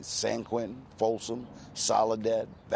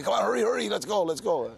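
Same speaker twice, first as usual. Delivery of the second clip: imitating the uncle's urgent hurry-up, pitched up high; rushed hurry-up; 10 semitones higher, 4 dB louder than usual. Uncle rushing him back to the car, urgent pitched-up hurry. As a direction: hurry them out like a getaway is on. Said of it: "he'd come back out and say".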